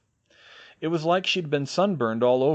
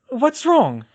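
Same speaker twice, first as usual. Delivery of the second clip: Molly voice; 13 semitones higher, 8 dB louder than usual. A narrator's reading.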